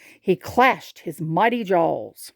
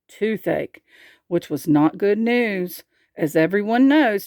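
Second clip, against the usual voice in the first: ordinary delivery, nothing marked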